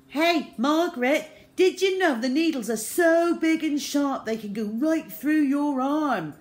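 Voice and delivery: put-on high, loud boy's scare story; gleeful taunting. A narrator's reading. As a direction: tease her with relish, loudly. Boy tries to scare girl.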